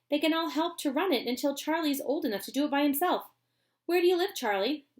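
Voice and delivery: keen to go at once; Mister Wonka. Acted. Wonka urges them to fetch the family, eager.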